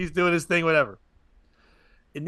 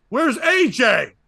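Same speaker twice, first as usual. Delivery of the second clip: put-on drunk heckle; 8 semitones higher, 6 dB louder, much slower than usual. Drunk heckler interrupting the show.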